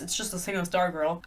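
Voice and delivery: soft mumbled self-correction; quiet aside. Real, not acted. Reviewer mumbling a correction to herself. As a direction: tuck it away under your breath.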